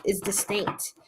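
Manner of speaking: usual speaking voice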